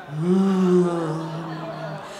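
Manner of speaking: long droning bohemian "aah"; mock mystical "aah". Storyteller mimicking her date's earth-mother sister, mock mystical drone. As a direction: hold a low, airy chant note.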